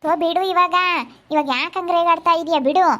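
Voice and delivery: impatient plea to drop it; exasperated protest. Real, not acted. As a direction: cut the ranting short, pitch up. Fed up with father's ranting.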